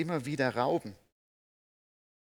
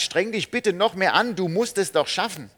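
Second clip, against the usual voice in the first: louder, higher nagging demand; pressuring appeal; 6 semitones higher, 10 dB louder than usual. Pretend. Imagined demanding God urging the congregation, loud and pushy. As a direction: push and scold like a disappointed taskmaster. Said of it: "er appelliert jetzt nicht an uns und sagt".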